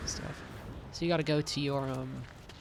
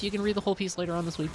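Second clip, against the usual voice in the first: ordinary delivery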